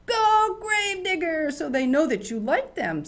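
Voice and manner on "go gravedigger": high, booming monster-truck cheer; yelled high and loud from the stands. Shown as a demonstration. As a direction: holler it out for your favorite truck. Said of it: "you can be really loud".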